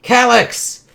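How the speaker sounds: high, loud challenging call. Acted. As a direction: yell the villain's name across the fight. Hero calls out to villain.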